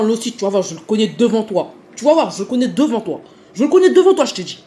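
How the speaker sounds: menaces criées, de plus en plus aiguës; imitation du type furieux. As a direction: crie comme un gars prêt à cogner. (le type qui hurle ses menaces)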